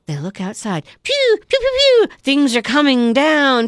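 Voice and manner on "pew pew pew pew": laser sounds. Retold or imitated. Imitating laser shots.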